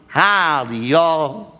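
drawn-out Texas twang; Texas accent. Shown as a demonstration. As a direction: greet like a true Texan. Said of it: "who has a true Texas accent".

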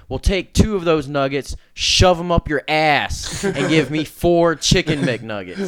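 loud, high, hammed-up demand; imitated over-the-top celebrity outburst. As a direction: bellow it slowly like a rude prank soundboard.

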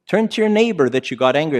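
ordinary talk, nothing marked

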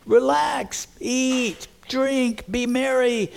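high, sustained, carefree lilt; self-satisfied rich man. Acted. Rich man in parable telling his soul to unwind, high and carefree. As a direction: lift each word high, as an indulgent invitation.

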